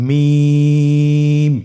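মিম with the vowel held very long and thin; slow held recitation of মিম; long drawn vowel. Shown as a demonstration. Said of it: "দুই ঠোঁট চেপে ধরে আমরা উচ্চারণ করব".

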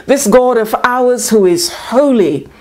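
normal talking voice

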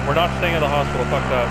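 loud, stressing not; loud refusal. Real, not acted. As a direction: refuse it loudly. Refusing to stay there.

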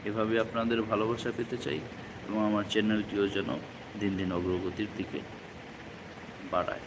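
ordinary delivery

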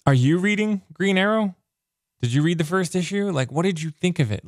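pitched high, eager questions to the viewers; bubbling over with excitement as he asks; excited. Real, not acted.

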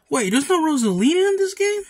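high, loud shock; loud disbelieving cry; surprised. Real, not acted. Surprised no Rosalina.

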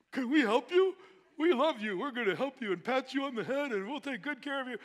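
high, loud patronizing mock voice, like coddling a child; mock smothering helper. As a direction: ham up the overbearing helper talking down. Mocking America's smothering help.

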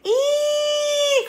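very high, loud squeal of pain; a little T-Rex shrieking as her finger is bitten. Acted. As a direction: squeal as the fish bites. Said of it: "cried Penelope".

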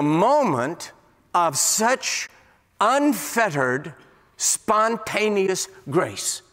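slow, drawn-out words on grace; preacher savours a moment of grace for the congregation, slowly; slow emphasis. Real, not acted.

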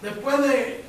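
loud, high fervent preaching; full preaching. Acted. Preacher urging the congregation toward the letter to Philemon, in fervent full-voiced preaching. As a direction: drive the point home to the pews.

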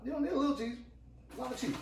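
sudden excited squeal about the cheese. Real, not acted. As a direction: pitch jumps way up, all hyped. Hyped cheese correction to viewers.

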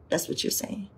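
whispered, no voice at all. Real, not acted. Preacher whispers the point back to the congregation. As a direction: breathe it to the room as a secret. Preacher repeats line, dropping voice.

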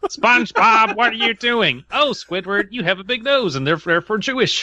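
high, loud squeaky cartoon impression; mock SpongeBob talking to Squidward; silly cartoon voice. Mocking cartoon character voice.

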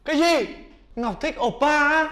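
can't believe his luck; high, loud amazed joy; delighted surprise. Acted. Hearing a confession.

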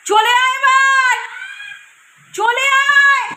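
loud, drawn-out sing-song call. Real, not acted. Calling kids in from outside.

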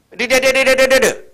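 high, loud rolling trill of an ox call; ox-driving call. Retold or imitated. Farmer trilling at his ox to turn, loud rolling call. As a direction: call the ox round the field corner with a rolling trill. Calling to the ox while ploughing.

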